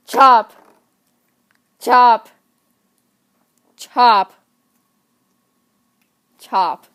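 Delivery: loud punchy chop sound effects. Acted. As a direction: hit each chop hard. Acting out axe blows.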